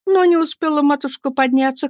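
usual speaking voice

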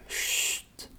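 soft voiceless shush; hushing. Acted. Professor demanding absolute secrecy, hushing. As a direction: hush them for secrecy's sake.

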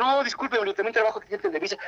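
high, hurried excuse; apologetic protest. Retold or imitated. Young employee excusing himself to secretary, high apologetic protest.

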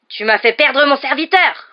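high, very loud roar of rage; enraged, drawn-out shout; furious roar. A narrator's reading. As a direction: roar it slowly at the culprit. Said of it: "rugit-il".

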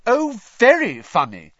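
high, loud, cutting sarcasm; sarcastic. A narrator's reading. Hermione to the laughing Slytherin girls, loud sarcasm. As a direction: throw it back at them, scornfully. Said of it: "Hermione said sarcastically".

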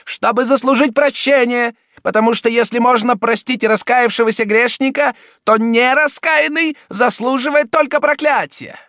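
high, loud angry prelate; angry exclamation. A narrator's reading. Cardinal voice.

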